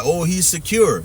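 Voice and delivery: sarcastic fangirl voice; mock praise. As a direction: parody the commenters' admiring tone. Mocking female commenters praising.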